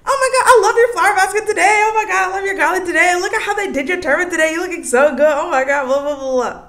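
high, fast, breathless compliments; excited babble over the deity's outfit; gushing fangirl. Retold or imitated. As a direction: rattle off compliments like a starstruck fan. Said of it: "'Cause I really, like".

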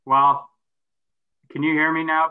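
normal talking voice

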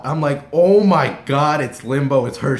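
reliving awe, heavy on the first words; freaking out. Retold or imitated. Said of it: "I freak out".

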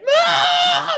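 high, loud mock scream for Mom; scared kid scream. Boy calls his mother when cousin appears, scared scream. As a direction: yell out like a terrified little kid.

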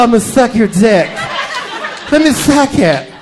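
lightly lisping imitation of Mo; mocking Mo's lisp. Comic mocking the woman's lisp to the crowd, lisping. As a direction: mimic her slow lisping come-on, hammed up. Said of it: "that is so ridiculous. It's a lisp".

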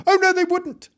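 very high little cat voice; protesting cat. Acted. The cat contradicts Gillian, high-pitched protest. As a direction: pipe up in squeaky contradiction. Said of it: "said Timbo".

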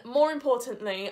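ordinary delivery

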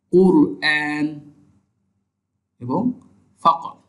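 কারা, কিলদ, কুরআন, ফাকর with a strong Arabic qaf; Arabic word demo. Shown as a demonstration. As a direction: recite the example words carefully. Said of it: "শাব্দিক উদাহরণগুলো".

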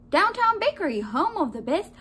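loud, high phone greeting; cheery baker. A narrator's reading. Baker answers the shop phone, cheery and loud. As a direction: sing out the shop slogan.

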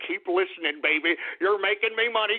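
usual speaking voice

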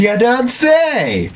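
high, very slow, mock amazement; sarcastic. Real, not acted. Friend mocking the obvious with slow sarcasm. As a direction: drag it out with heavy sarcasm.

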